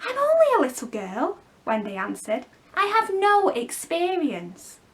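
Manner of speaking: put-on a girl unsure of herself; modest little girl. Wendy answers the boys.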